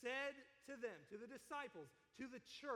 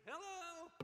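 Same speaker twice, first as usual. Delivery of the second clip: high-pitched drawn-out hello; playful high holler; mock wake-up call; 7 semitones higher than usual. Acts out waking a sleeping spouse.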